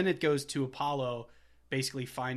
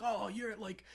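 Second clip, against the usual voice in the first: mock outraged Paulie; 9 semitones higher than usual. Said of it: "So he's like".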